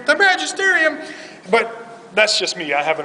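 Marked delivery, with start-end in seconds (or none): 0.1-1.0 much higher, louder hammed-up exclamation; triumphant mock cry. Imagined Pope calling for the magisterium, hammed-up triumphant shout. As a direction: proclaim it like a superpower in a cartoon.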